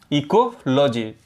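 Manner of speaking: Ecology drawn out slowly, syllable by syllable; slow careful repeat. Shown as a demonstration. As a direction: spell out ecology slowly for the class to copy. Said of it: "teacher says the term twice". (Ecology को धीरे-धीरे, साफ़ बोलें)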